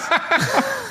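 high-pitched wired giggle; giggle, buzzing on caffeine. Real, not acted. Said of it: "I'm wired right now".

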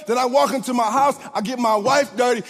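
ordinary talk, nothing marked

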